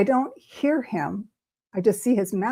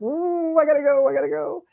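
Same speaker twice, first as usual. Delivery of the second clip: high-pitched mock panic; flustered exit; 8 semitones higher than usual. Fleeing the family after freaking out, high and flustered. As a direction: squeal it lightly as you make your escape.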